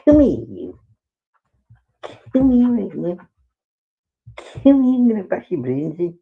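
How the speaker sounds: high, playful pet-talk threat; mock threat to the dog. Owner calling her dog over, playful mock threat. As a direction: fake menace, all affection.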